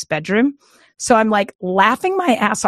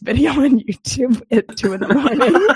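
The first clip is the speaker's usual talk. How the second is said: laughing; 5 semitones higher than usual. Real, not acted. Laughs at own confession.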